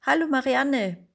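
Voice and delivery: high, loud excited greeting; excited call. A narrator's reading. Woman calls her childhood friend, excited and loud. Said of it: "rief sie aufgeregt".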